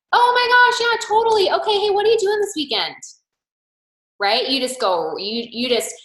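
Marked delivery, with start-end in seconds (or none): 0.1-3.0 high, bright, fast fake friendliness; fake cheery brush-off. To a friend who said no, sugary high chirp.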